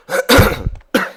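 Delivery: sick, harsh cough; real cough. Real, not acted. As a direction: cough through a sore throat. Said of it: "Ah là, là, je vais mourir". (toux rauque de malade)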